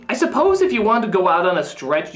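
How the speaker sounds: usual speaking voice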